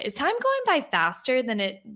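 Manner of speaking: ordinary delivery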